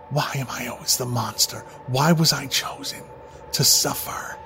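soft, broken lament; despairing murmur. Acted. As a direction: ask it quietly in despair. Despairing after self-whipping.

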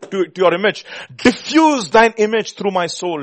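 loud, high, urgent pleading; full-force preaching. Real, not acted. Preacher confessing a wayward body to the Lord, full force. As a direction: pour out each phrase as a forceful confession.

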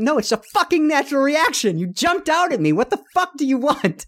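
high-pitched exasperated retort; indignant, pitch way up; exasperated outburst. Retold or imitated. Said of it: "I'm like".

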